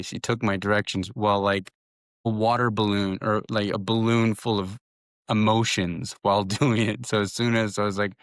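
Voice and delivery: ordinary talk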